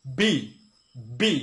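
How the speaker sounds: "বি" loud, b punched like a beat; correct letter B. Shown as a demonstration. Teacher applies the beat to the letter, "বি" punched loud. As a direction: pop "বি" out forcefully, one at a time. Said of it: "তাহলে এটা হবে".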